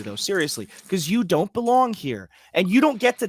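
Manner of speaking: usual speaking voice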